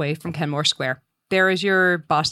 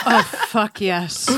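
slow, laughing delight; drawn-out laughing yes; much slower than usual. Real, not acted. Laughing reply to glitter joke.

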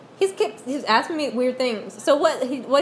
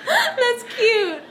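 high, squeaky words through laughter; laughing; 12 semitones higher than usual. Real, not acted. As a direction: laugh while saying it. Laughing at the Croc sticker.

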